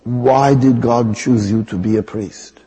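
put-on slow, probing question in an Indian accent; Mother Teresa's Indian accent. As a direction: imitate her Indian accent as she presses him. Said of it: "I have to use the accent".

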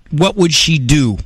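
usual speaking voice

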